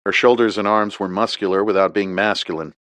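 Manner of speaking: ordinary delivery, nothing marked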